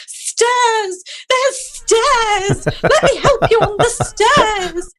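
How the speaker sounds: high-pitched panicky helper, hammed up; mock shrieking. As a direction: shriek it like an over-eager bystander. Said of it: "someone starts shrieking at you".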